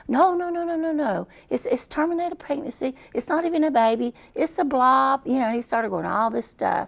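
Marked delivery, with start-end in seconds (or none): 0.1-5.2 much higher, quick brushing-off no's; doctor waving off her objection, higher and brisk; dismissive doctor. Retold or imitated. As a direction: wave her objection away like it's nothing. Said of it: "he kept saying".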